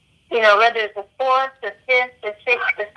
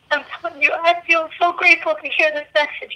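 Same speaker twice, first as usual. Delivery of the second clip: higher, near tears with gratitude; choked up, grateful; 6 semitones higher than usual. Real, not acted. Guest thanks the healers, close to crying. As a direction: voice lifts as tears come. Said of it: "I'm gonna cry hearing this".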